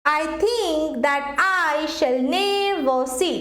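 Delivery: very slow, alternating light and heavy beats, with "think", "I", "ne" and "see" stressed; iambic scansion. Shown as a demonstration. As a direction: stretch "think", the second "I" and "ne" of "never". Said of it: "একবার stress কম পড়ছে, একবার বেশি পড়ছে".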